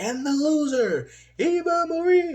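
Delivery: high-pitched mock ring announcer call. Partner mocking Eva Marie's loss, high announcer call. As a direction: belt it out like a gloating announcer. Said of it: "She's like".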